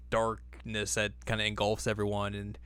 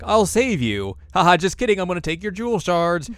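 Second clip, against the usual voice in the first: mock villain taunt; 7 semitones higher, 6 dB louder than usual. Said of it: "is like".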